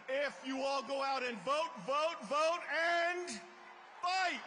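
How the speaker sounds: loud climbing chant to a yell; rally speech. Real, not acted. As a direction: build to a big shouted finish.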